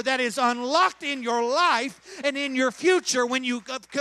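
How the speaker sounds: loud, rising preacher's climax; full-force preaching. Acted. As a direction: build and shout it to the congregation.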